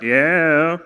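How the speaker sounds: mock unimpressed, loud drawn-out reply. Comic mimicking an unimpressed man in the crowd, unimpressed. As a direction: imitate someone who is not won over. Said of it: "this guy's unimpressed by it".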